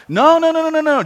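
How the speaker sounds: high, insistent no's from the caller; lightly voiced caller protesting; mock caller. Pastor voicing the caller's high protest.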